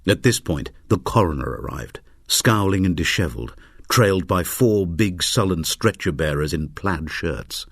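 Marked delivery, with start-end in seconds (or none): none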